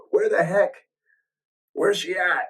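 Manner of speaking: a hint of a cranky old client complaining; grumpy old man. Studio owner imitating the grumpy old student, mildly grumpy. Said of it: "he's all grumpy old man".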